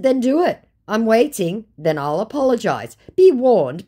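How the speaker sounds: loud, high taunting dare. Retold or imitated. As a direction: throw down the gauntlet. Daring the scammer to call.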